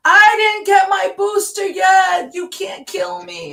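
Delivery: high hysterical shout, words stretched out; mock screaming. Imitating half a church fighting the other half, mock screaming. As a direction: shriek it in imitation of a panicked churchgoer. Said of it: "screaming at each other".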